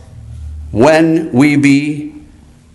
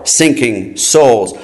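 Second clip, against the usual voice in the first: usual speaking voice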